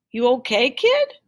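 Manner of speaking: high, quick concern; concerned man. A narrator's reading. Can man asking Tim if he's okay, concerned. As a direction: check on the kid kindly.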